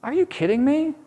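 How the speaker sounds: high, incredulous outburst as God; God throwing up his hands in disbelief; a betrayed God asking if this is a joke; exasperated God. Acted. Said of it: "And God is like".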